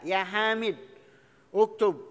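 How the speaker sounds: "Hamid" stopped on d, a gap, then "uktub"; loud, separated "Hamid... uktub"; pause between words. Shown as a demonstration. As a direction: break after "Hamid", begin "uktub" afresh and loud.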